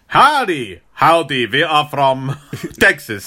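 spy pretending to be from Texas, mock drawl; mock Texan accent. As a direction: fake Texan greeting, laughing at the end. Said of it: "explain their accents".

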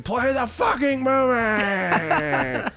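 high, slow mock yell at the screen; retold drunken shout. Drunk teen yelling at the movie screen, slow mock shout. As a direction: drag it out like a drunk heckler. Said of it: "I remember at a certain point shouting".

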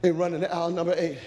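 ordinary talk, nothing marked

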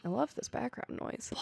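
amused whisper aside. Real, not acted. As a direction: whisper it under the drums. Aside during drum sound effects.

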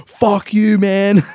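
high, loud insult; shouted insult. Pretend. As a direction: yell it back defiantly. Shouting back at boss.

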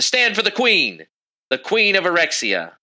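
high-pitched, measured royal herald; stern announcement with little added volume; loud stern command. Acted. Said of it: "in a very loud and stern voice".

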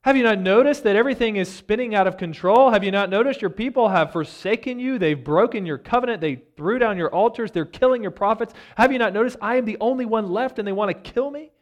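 high, loud and fast exasperated plea; loud exasperated outburst. Retold or imitated. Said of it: "he's exasperated".

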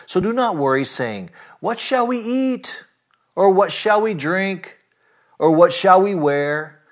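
mock the anxious questions with a lilt; sarcastic. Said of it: "I'm not sure if Jesus used that kind of intonation".

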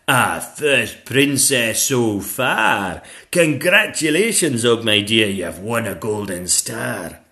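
loud, slow proud schoolmistress; congratulating dragon teacher. Acted. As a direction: praise your pupil before the class. Madam Dragon congratulates Zog.

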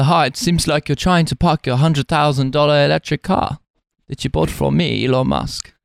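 a slow, badly done South African impression; Elon Musk. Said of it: "speaking in Elon Musk's voice".

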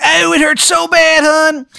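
high, slow, moaning falsetto; dying Steve. Acted. GM voicing the murdered Steve's dying recording, high pained moan. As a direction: whimper it out in a pained squeaky voice. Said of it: "He's just like".

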